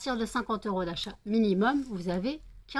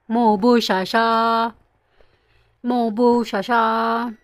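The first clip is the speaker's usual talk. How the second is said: câlin au chat; 11 dB louder, much slower than usual. Real, not acted.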